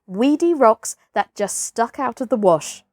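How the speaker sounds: ordinary delivery, nothing marked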